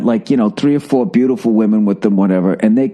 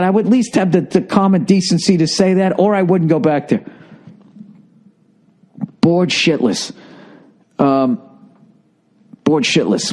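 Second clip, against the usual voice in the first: ordinary delivery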